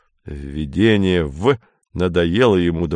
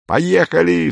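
much higher, eager burst of agreement; exclaimed; 9 semitones higher, 3 dB louder, slower than usual. A narrator's reading. Paul leaping up to back Lin's plan, exclaiming. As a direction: jump up and cry it out. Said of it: "вскричал Поль и вскочил".

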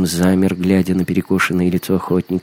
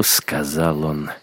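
plain reading voice

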